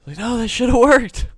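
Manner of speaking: high, loud mock-exasperated yell; frustrated. Coder replaying his struggle with animations, mock frustration. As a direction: re-enact a frustrated protest, hammed-up. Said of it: "hear the frustration in my voice".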